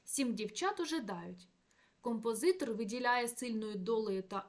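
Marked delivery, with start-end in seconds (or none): 0.0-1.5 дівчат given the main verse stress, сім left light. Shown as a demonstration. Lecturer scans the ballad line with stress on дівчат. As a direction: lean on дівчат as the anapest asks. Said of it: "при прочитанні віршованим ритмом наголос падає на слово".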